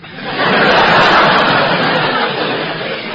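big belly laugh, loud and long; long hearty laugh. Acted. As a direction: laugh out loud for a few seconds. Laughs at her own complaint.

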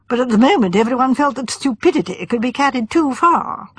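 usual speaking voice

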